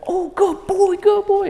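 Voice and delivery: squeaky happy dog praise; high praise voice. Shown as a demonstration. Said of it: "I would say".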